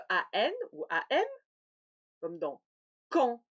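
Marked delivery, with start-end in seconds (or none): 2.2-3.4 quand with open nasal an. Shown as a demonstration. Teacher demonstrating nasal an in quand. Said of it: "Le A N, deux types de graphie".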